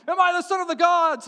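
high, loud declamation; grand calling out. Acted. Alexander questioning the oracle's voice, booming out. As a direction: call into a great hall.

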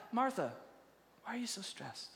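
soft, gentle question after an outburst; quiet, tender concern; calm reassurance. Acted. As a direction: soothe her, dropping to near-hush. Voices Jesus calming screaming Martha.

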